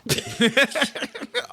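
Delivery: sudden high laugh, loud; high burst of laughter. Real, not acted. Cohost laughing at the Terminator joke, high laugh. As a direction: crack up at the joke.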